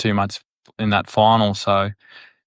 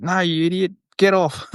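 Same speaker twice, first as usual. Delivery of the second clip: high-pitched exasperated scold; mock scolding physio; 9 semitones higher than usual. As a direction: play the physio telling him off, pitched up. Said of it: "And they're like".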